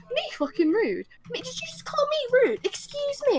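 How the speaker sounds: high, incredulous retort; indignant. Retold or imitated. Turning round to the man who called them rude, indignant. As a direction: climb into high-pitched affronted disbelief.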